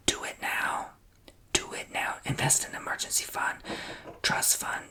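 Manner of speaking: normal talking voice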